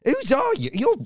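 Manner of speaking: high-pitched, exasperated retold question; mock indignant demand. Comic picks up a stray kid mid-show, mock indignant.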